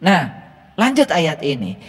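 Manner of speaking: ordinary talk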